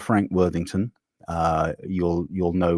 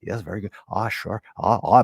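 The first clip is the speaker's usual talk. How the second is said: Jeff Goldblum. Retold or imitated. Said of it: "it turned into that".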